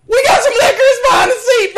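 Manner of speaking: laughing mock confession.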